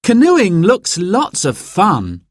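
put-on excited child. A narrator's reading. Narrator voices child Sarah.

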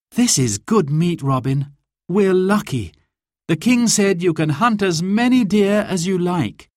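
lightly performed, high-set storybook heroine; Marion's cheerful chat. A narrator's reading. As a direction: lift the voice for a lively remark. Marion voiced at dinner.

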